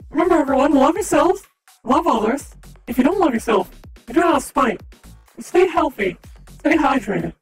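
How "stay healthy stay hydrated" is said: ordinary talk, nothing marked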